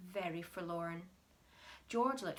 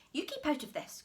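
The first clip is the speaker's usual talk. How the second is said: raised, higher voice; crying out; 7 semitones higher, 4 dB louder, faster than usual. Acted. Mister Cranky snapping at his mother-in-law. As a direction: cry out to keep them back. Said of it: "cried Mister Cranky".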